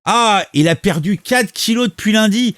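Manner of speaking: mocking repeat. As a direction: throw the words back with a sneer. (répétition moqueuse, plus haut et plus fort)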